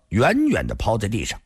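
ordinary delivery

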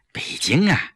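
half-whispered, faltering, with a low sigh; evading her friend's question about going home, hesitantly; hesitant, evasive. A narrator's reading. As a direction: dodge the question, trailing off. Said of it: "季嫣然支支吾吾地说".

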